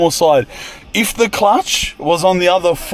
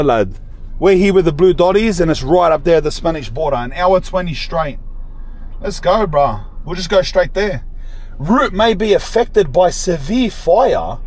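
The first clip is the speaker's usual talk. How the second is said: usual speaking voice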